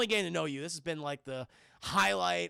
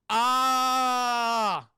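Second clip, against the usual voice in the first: long, loud held "ah"; mock scream; 4 semitones higher, 9 dB louder than usual. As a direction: hold a loud scream, played for laughs. Reads out the phone's scream.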